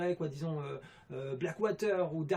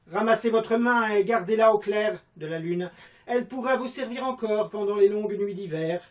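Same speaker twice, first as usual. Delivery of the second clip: loud, high classical declamation; grand theatrical stage voice; Molière theatre style; 6 semitones higher, 12 dB louder than usual. Pretend. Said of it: "à la Molière".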